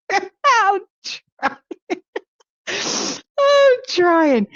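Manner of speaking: high, wheezing laugh-talk; high, breathless laughter; laughing hard. Real, not acted. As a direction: squeeze the words out through laughter.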